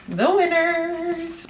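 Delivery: high, slow, drawn-out announcement; sing-song high title drop, slowly; dramatic title reveal. Acted. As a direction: stretch the title out like a grand reveal.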